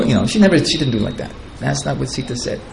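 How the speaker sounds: normal talking voice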